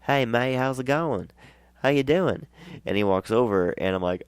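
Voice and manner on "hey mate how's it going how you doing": put-on high, friendly Australian accent; imitated Australian accent, lifted and cheery; Aussie accent. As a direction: greet like an Aussie mate, pitched up. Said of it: "in that wonderful Aussie accent".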